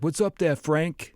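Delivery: high, hammed-up Brooklyn accent. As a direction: greet him like a Brooklyn guy. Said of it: "talk to him in, like, a Brooklyn accent".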